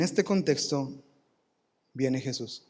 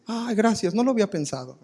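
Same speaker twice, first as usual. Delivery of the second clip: sarcastic thank-you, pitched up; mock gratitude; 6 semitones higher than usual. Sarcastic reply to a friend's empty advice, mock thanks. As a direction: play the grateful friend with heavy irony. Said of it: "Qué buena idea".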